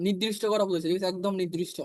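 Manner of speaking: usual speaking voice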